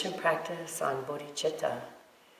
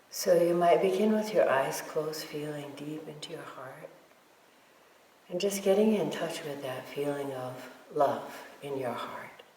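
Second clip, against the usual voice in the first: guided meditation. Real, not acted. Teacher guiding meditators to feel love for all beings, guided meditation. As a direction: lead them gently, leaving silence between phrases.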